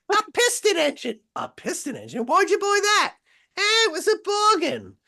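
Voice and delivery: falsetto English gossip, trading lines; two put-on English missuses, very high; mock English housewives. Couple mimicking overheard English chat, mock housewife voices. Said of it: "English stuff and just loving it all".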